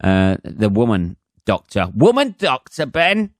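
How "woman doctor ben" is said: high-pitched, scandalised echo; mock incredulity. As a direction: repeat it as if outraged, voice jumping right up. Mock shock at his own phrase.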